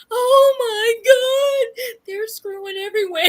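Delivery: laughing shocked outcry; shocked exclamation. Retold or imitated. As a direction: yell it out in mock shock. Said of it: "I was like".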